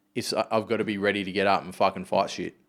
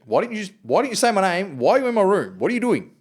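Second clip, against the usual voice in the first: higher, louder string of questions; mock exasperated dad; 10 semitones higher, 5 dB louder than usual. Dad baffled by silent kids, a high exasperated rant. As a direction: pile on the questions, half laughing.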